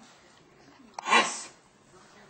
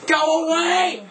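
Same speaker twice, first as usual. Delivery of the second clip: laughing shout; 8 semitones higher, 8 dB louder than usual. Acted.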